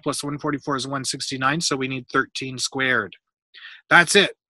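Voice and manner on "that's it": loud, higher burst of realisation; eureka moment. A narrator's reading. Boy solving the ladder problem, eureka moment. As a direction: exclaim it as the answer clicks.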